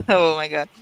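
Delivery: usual speaking voice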